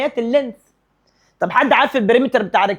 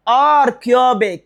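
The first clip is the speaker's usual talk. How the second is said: R cubic drawn out very slowly and stressed; 3 semitones higher, 4 dB louder, much slower than usual. Shown as a demonstration. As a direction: spell out R cubic slowly for the class. (نطق R cubic ببطء شديد وتشديد)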